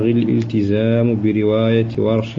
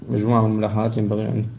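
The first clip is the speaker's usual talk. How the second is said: ordinary talk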